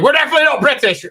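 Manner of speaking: quick, high, mocking "we're definitely not British"; mock Irish voice. Irish side mocking their neighbours, high and quick. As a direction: toss it off as a proud Irish rebuttal.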